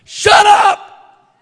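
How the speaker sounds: high, loud rebuke at the storm; commanding shout. Acted. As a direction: bark it at the storm. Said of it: "tell it like the storm".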